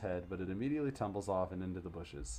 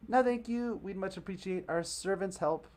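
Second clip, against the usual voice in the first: put-on snooty little girls; 11 semitones higher, 5 dB louder than usual.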